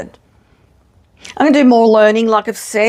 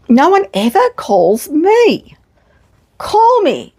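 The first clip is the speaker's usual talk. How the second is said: high, slow and pleading; hammed-up sulky plea for a phone call; mock whine; 8 semitones higher, slower than usual. Fed-up organiser begging her friends to ring, mock whine.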